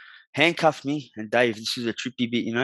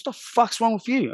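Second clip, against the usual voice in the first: much higher, alarmed wife's voice; imitating his wife; 10 semitones higher than usual. Wife walking in on him, higher alarmed imitation. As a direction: voice her shocked at the door.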